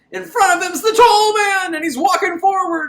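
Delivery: suddenly high and loud, thrilled storytelling; hyped retelling of the tall man stepping forward; excited recap. Real, not acted. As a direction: pitch way up as the villain appears. Excited recap of villain appearing.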